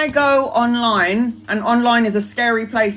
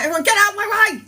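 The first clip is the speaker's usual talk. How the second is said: mock urgent shout; 10 semitones higher, faster than usual. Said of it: "I'm like".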